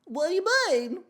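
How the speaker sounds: high, loud put-on baby voice; terrifying baby voice. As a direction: squeal it like a creepy baby. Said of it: "The voice was terrifying".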